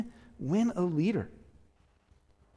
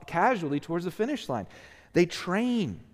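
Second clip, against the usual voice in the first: ordinary talk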